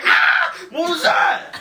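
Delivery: loud high panicked wake-up; startled waking yell. Retold or imitated. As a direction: yell as if jolted awake. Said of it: "he's like".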